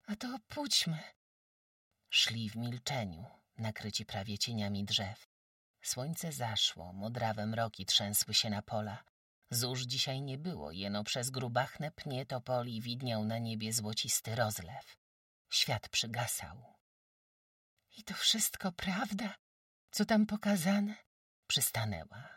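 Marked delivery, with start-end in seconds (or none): 0.1-1.0 put-on high, bright village girl; high girl's voice. Jagusia's voice. 18.0-20.9 high breathless whisper; awed whisper. Acted. Jagusia whispers in awe.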